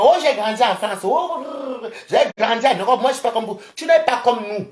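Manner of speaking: high, loud mock bragging; mocking boast. Mocks people boasting of growing up abroad.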